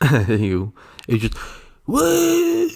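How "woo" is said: very high, baffled "woo"; mimicked high hoot of bewilderment; confused waiter's "woo". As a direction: squeak out a stranger's total confusion. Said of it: "he was quite confused".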